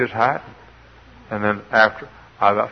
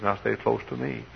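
gentle warning; 7 dB quieter than usual. Retold or imitated. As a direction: say it quietly, as kindly advice. Lord's first, gentle warning.

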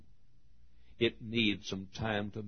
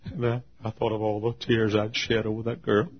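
usual speaking voice

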